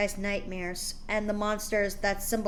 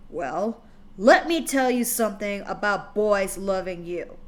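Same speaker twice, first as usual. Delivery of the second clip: a lightly voiced growling father, louder; growled; 6 dB louder than usual. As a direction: growl it as a threat, raised voice. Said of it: "he growled".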